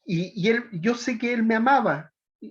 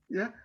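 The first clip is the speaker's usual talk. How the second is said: tearful laugh; 3 semitones higher, 7 dB quieter than usual. Real, not acted. Emotional after voicing mother.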